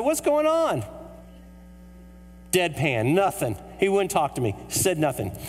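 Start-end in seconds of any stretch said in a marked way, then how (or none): 0.0-0.8 high-pitched, mock-urgent question; exaggerated nosy tourist demanding to know; incredulous asking. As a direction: blurt it out high, falling sharply at the end. Retold calling out to guard.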